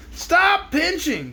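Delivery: high, slow pained cry. Acted. Zebra crying out at the crab's pinch. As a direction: yelp in pain from the pinch. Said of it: "cried the zebra".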